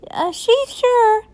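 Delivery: put-on high, slow kid voice; stall, then answer as a child; high-pitched drawn-out cover-up; schoolkids. A narrator's reading. Kids answering for the teacher.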